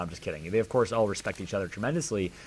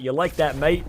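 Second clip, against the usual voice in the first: louder playful go at British or Australian; mock British-Australian accent; 4 semitones higher, 7 dB louder than usual. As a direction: try to outdo a bad British-Australian accent. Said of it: "I tried doing a better job".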